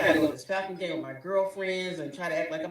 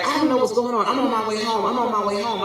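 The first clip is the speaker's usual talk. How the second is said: higher, louder flustered husband; husband's nervous denial; 6 semitones higher, 7 dB louder, faster than usual. Retold or imitated. Husband on the phone, caught and rushing to deny. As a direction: stammer it out like he's caught. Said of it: "So he was like".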